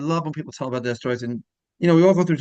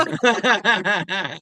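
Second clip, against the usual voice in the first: laugh; 6 semitones higher than usual. Real, not acted. Host laughs at joke.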